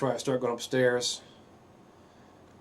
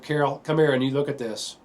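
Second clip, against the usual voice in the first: retold yell; 4 semitones higher, 6 dB louder than usual. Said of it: "I yelled up to".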